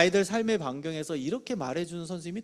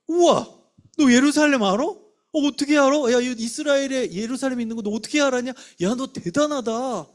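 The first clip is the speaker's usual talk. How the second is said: high, loud wow at a pupil; acted astonishment, bursting with admiration; amazed praise; 8 semitones higher, 8 dB louder than usual. Shown as a demonstration. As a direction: marvel wholeheartedly at the child's answer. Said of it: "그걸 인정해 주는 거예요".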